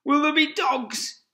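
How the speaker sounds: high, loud scared small fox; frightened cub. Acted. Cub asking his mother, frightened and high. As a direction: ask like a frightened little cub. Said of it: "he said".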